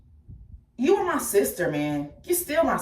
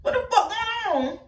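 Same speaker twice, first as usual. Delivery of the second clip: shocked confusion; 11 semitones higher than usual. Retold or imitated. Retelling finding her siblings gone, high shocked disbelief. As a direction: act out waking up to find them gone. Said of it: "And I'm like".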